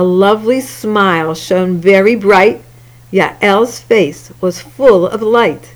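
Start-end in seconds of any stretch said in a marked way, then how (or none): none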